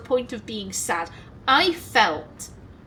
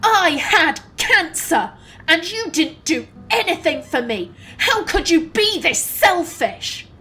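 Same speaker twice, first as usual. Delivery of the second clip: slow, higher, acted fury; imitating an outraged mum, each phrase drawn out; explosive outburst; 5 semitones higher, 4 dB louder than usual. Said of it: "my mum explodes".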